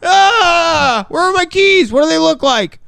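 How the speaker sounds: high, lost, confused voice; forgetful goldfish. Pretend. Mocking friend's goldfish memory, high confused voice. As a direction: play a goldfish who forgot everything. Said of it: "I'm just Dory from Finding Nemo".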